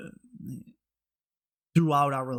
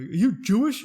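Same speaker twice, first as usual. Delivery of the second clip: light mock questioner, higher and louder; imitated stranger's question; 7 semitones higher, 6 dB louder than usual. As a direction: pop the question up, lightly acted. Stranger's question acted in anecdote.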